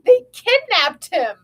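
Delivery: high, loud, drawn-out exclamation; dramatic outcry. Acted. Mock outrage in lively reading.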